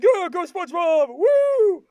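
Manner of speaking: high, loud whooping cheer; cheering Patrick. Retold or imitated. Patrick cheers on SpongeBob at the flaming hoop, high excited shouting. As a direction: cheer him on with a rising "Woo!". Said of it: "Patrick's cheering 'cause he's like".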